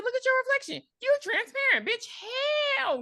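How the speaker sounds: high-pitched incredulous outburst; exaggerated, squealing disbelief; mock shock. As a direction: play a brother freaking out at what he sees. Acts out Sam's shocked accusation.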